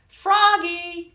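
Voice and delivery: high, loud call of a name; teacher crying out. Acted. Teacher calling the dreamy frog in class, loud and high. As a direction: snap the daydreaming pupil back to attention. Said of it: "cried his teacher".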